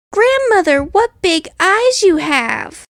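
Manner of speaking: put-on high, wondering little girl; a girl marvelling at her granny; the girl in bed asking her grandmother, high and amazed. A narrator's reading. Girl voiced in story.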